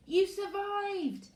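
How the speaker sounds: put-on high, slow astonished boy; amazed boy. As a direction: say it in disbelief. Fred amazed at survivors.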